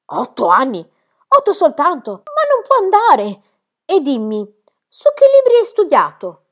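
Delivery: very high, loud scandalised cry; rising in shock at each answer; appalled governess. Acted. Rottenmeier reacts to the child's age with rising outrage.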